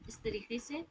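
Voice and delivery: ordinary talk, nothing marked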